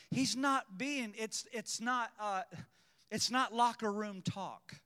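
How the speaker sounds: ordinary talk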